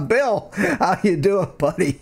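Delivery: slow, drawn-out friendly hello; warm greeting. Real, not acted. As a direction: greet a buddy warmly. Greeting viewer warmly, drawn out.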